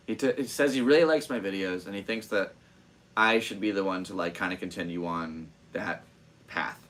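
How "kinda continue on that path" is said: normal talking voice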